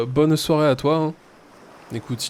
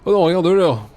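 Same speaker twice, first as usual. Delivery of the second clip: surprised; 5 semitones higher, 7 dB louder than usual. Acted. Reading game dialogue aloud excited.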